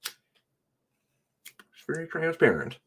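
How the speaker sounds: sarcastic. Real, not acted. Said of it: "It's not clear".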